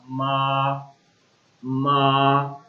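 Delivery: मा drawn out slowly on one flat pitch; straight first tone. Shown as a demonstration. Teacher modeling a straight-line tone slowly. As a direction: hold मा long and level. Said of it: "sound जो होगा वो आपका straight होगा".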